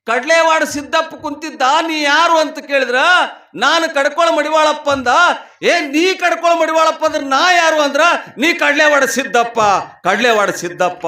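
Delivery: high, loud sermon storytelling; full-voiced preaching. Preacher retelling guru and disciple's exchange, full-voiced preaching.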